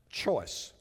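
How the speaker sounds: ordinary delivery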